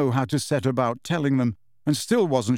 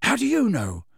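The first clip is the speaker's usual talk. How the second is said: high-pitched, sharp challenge; 10 semitones higher than usual. A narrator's reading. Ron questioning Hermione's reassurance, sharp. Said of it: "said Ron in a sharp voice".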